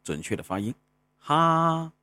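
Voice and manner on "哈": 哈 said alone, clear and higher; sounding out initial. Shown as a demonstration. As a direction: model 哈 like a laugh syllable. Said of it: "用哈哈笑的哈来找到它的准确的发音".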